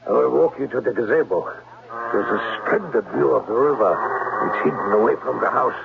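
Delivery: put-on German accent. German-accented Klemper lures the governess to the gazebo. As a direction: charm her in a heavy German accent.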